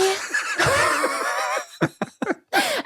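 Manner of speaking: helpless shriek of laughter; high shrieking laugh. Real, not acted. Remembering the doll's name, shrieking with laughter.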